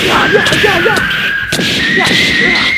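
very loud, high chanted yells; power-up yell. Acted. Fighter charging to full power, screaming.

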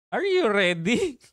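laughing, slow needling question; teasing challenge. Real, not acted. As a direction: tease him as you ask it.

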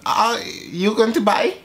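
high-pitched mock broken-English woman; broken English accent. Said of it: "I like broken English".

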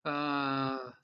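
usual speaking voice

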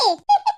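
playful squeal of a laugh; teasing giggle. Acted. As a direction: giggle at her, teasing about the mother-in-law. Teasing about future mother-in-law.

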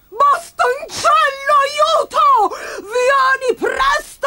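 very high, drawn-out shriek; stretched, wailing storybook scream; piercing cry for help. Acted. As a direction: scream for help as a panicked granny. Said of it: "echeggiò un grido lacerante".

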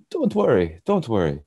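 imitating a chef with a Russian accent; put-on Russian accent. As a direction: reassure in an accidental Russian accent. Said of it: "I'm putting on a Russian accent".

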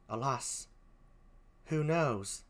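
poor put-on British accent, very soft; Emilia. Gentlewoman laments her mistress's death, softly in a British accent. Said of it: "please forgive my poor British accent".

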